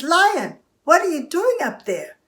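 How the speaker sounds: high, loud call upward; astonished mouse. Acted. Mouse finding lion hanging in tree, astonished. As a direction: call up in high-pitched surprise.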